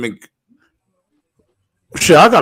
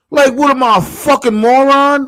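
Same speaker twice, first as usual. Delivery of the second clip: high scoffing disbelief; incredulous; 12 semitones higher than usual. Real, not acted. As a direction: act personally insulted. Mocking the gimmick indignantly.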